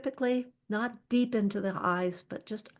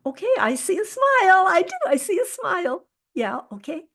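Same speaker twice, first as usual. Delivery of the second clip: much higher and louder, bright encouragement; a little energy; 10 semitones higher, 8 dB louder than usual. Shown as a demonstration. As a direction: lift someone out of the give-up state. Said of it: "I start to add a little energy".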